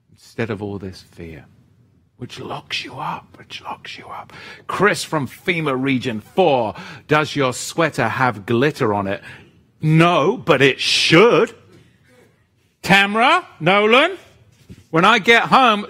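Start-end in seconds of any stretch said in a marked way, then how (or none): 0.1-1.5 lower, softer repeat; soft echo. Acted. Echoing it softly. 2.2-3.4 much softer, drawing in; hushed. Acted. Dramatic sermon point. 3.4-4.3 breathy whispered repeat. Acted. Dramatic sermon point whispered. 9.8-15.9 high, loud mock-flamboyant; playful excitement. Joking about glitter sweater.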